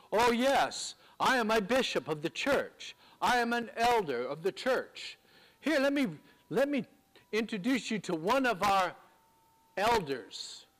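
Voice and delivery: puffed-up mock introduction, dropping on "elders"; mock self-importance. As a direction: parody someone showing off a badge of honor. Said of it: "as sort of a badge of honor".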